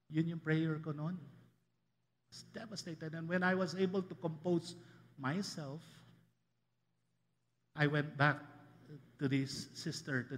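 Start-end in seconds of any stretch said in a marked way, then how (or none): none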